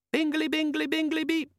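very high, squeaky electronic chirp; chirpy gadget chime. Acted. The demon announcing itself to Vimes, squeaky chime. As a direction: chirp it like a tiny pocket gadget.

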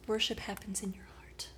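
soft whispered wisdom; gentle whisper. Retold or imitated. Jesus telling the woman softly where worship happens, whispered. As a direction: whisper it tenderly. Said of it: "he's like".

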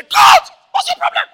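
loud, high shouted cut; angry director. Retold or imitated. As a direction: yell cut like a furious director. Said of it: "Director say".

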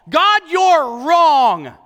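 slow, drawn-out accusing complaint to God; Jonah complaining. Retold or imitated. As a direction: draw out the accusation, high and loud.

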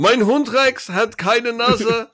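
high, loud, slow German declamation; Monty Python joke delivery. Acted. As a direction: proclaim a silly joke in drawn-out German. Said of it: "The Monty Python. It was the funniest joke".

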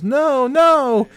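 high, loud panicked yell. Retold or imitated. Lenny yelling at kids running away, high loud panic. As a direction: scream it after the fleeing kids. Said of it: "Lenny gets up and yells".

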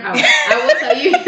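laughing while talking fast. Real, not acted. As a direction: giggle through the words. Excited about their Instagram.

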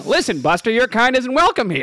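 gangster's moll. Pretend. 1920s bigot role play.

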